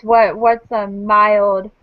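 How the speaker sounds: usual speaking voice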